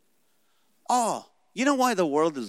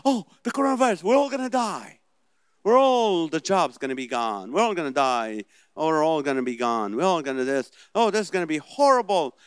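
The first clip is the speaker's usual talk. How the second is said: mock panic; 3 semitones higher, 5 dB louder than usual. Preacher mocks people panicking about the virus, mock panic. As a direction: ham up a panicked doomsayer.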